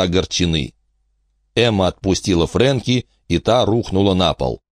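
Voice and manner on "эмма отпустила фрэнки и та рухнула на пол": plain reading voice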